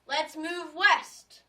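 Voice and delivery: loud, drawn-out cry of let's move west; rallying shout. Acted. Jefferson rallying the class to go west, a slow loud shout. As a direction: call the crowd to follow you.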